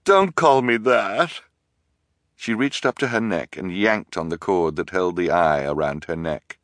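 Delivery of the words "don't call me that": sobbing. A narrator's reading. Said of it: "she went on sobbing".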